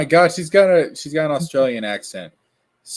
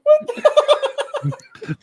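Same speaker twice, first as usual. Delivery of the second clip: laughing squeal; 4 dB louder than usual. Real, not acted.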